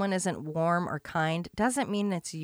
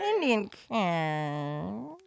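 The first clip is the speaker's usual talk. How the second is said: put-on slow, shaky old-lady voice; frail shaky old whisper; much slower than usual. Shown as a demonstration. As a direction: drag it out with a trembling voice. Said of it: "are you a shaky, shaky voiced old lady".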